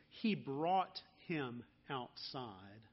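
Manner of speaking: "He brought him outside" said slowly and low, leaning on "brought"; slow it down and land on "brought"; stress on brought. Shown as a demonstration. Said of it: "note this phrasing".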